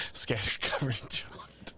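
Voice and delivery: soft whispered echo; whispering. Pretend. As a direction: breathe the order under your breath. Whispered repeat of the order.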